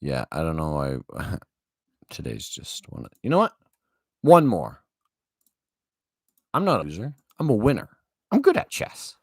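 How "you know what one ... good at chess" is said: higher-pitched, defiant rallying cry; sudden determined burst, pitched up; self pep talk. Real, not acted. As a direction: psych yourself up after a loss. Self pep talk after losing.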